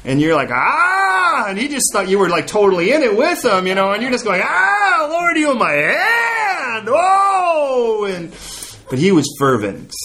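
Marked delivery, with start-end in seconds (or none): 0.5-1.5 high, drawn-out "ah" of pain; hammed-up cry from a crushed hand; mock pained yell. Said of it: "he'd squeeze your hand so tight". 4.4-8.0 high, drawn-out cry of pain; hammed-up howl from a crushed hand; mock pained wail. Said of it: "he'd squeeze your hand so tight".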